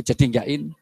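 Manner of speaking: ordinary talk, nothing marked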